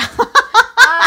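big high cackle; loud laugh. Real, not acted. Laughing at own story.

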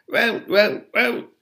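high, repetitive elf croak; continued croaks. A narrator's reading. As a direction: croak the word over and over. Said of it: "Creature's continued croaks".

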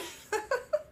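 a quick high-pitched giggle. Real, not acted. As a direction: laugh up high, short and bright. Laughing at friend's cameo.

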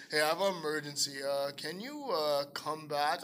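low, stilted deadpan with hesitations; unconvincing, emotionless mock emergency; no emotion. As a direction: recite it like a hopeless actor. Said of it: "like, no emotion at all".

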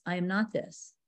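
softly noted to oneself; a hushed reminder to yourself; quiet inner noting. Shown as a demonstration. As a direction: say it inwardly, hushed. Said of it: "we just need to pause and notice".

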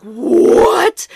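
loud, panicked outburst; mock a burst of alarm; instant panic. Wife hears the sex may be wrong, retold loud panic. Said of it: "is instant panic".

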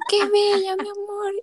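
high, sweet mom voice; mom's tender reply. Retold or imitated. Mom thanking her daughter for the roses, high. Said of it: "Y mami".

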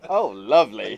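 breathy delighted posh reaction; British porno voice. Pretend. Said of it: "the most British porno ever".

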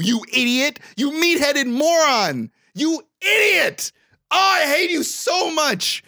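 high-pitched, hammed-up furious tirade; exasperated rant. Creditor tearing into Sean over his debt, exasperated high-pitched rant. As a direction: rail at him in comic outrage, drawing out the final insults. Mock rant at Sean's stupidity.

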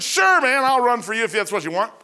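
loud, high call up to the boss; answering a shouted order from below; calling back. Retold or imitated. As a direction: shout back with mock eagerness.

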